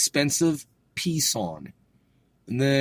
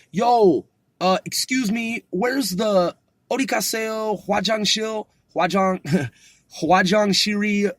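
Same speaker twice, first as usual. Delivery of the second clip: much higher, lightly hammed-up excuse-me question; mock tourist asking; 7 semitones higher than usual. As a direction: play a lost guy hunting for the toilet. Playacting asking for the restroom.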